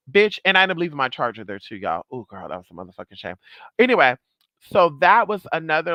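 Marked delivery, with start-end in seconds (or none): none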